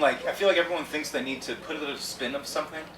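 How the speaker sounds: normal talking voice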